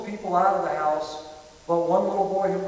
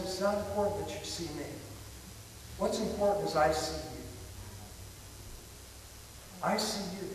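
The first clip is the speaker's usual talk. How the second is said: calm reassurance; 8 dB quieter than usual. Retold or imitated. Father to his terrified son on the roof, calmly reassuring. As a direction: reassure gently, with long pauses.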